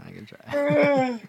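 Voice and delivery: slow, high put-on attempt at a Scottish accent; mock Scottish attempt. Guest trying a Scottish accent for the hosts, put-on. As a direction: stretch it out in a bad Scottish accent. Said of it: "the accent you're looking for is".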